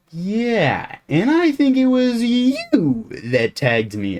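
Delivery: put-on high, loud, stretched-out kid voice; imitating little kid. Small kid meeting the camper who tagged him, hammed-up squeal. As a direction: squeal it like a kid recognising someone.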